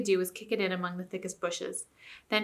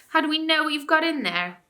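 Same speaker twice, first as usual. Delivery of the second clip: loud, cheeky dwarf voice; heckling dwarf; 8 semitones higher, 5 dB louder than usual. Acted. Dwarf heckling the ape, loud and cheeky.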